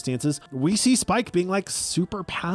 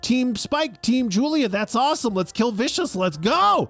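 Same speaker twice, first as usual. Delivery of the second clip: mock excited Spike; 6 semitones higher than usual. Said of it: "Spike finds Julia".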